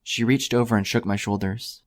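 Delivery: ordinary delivery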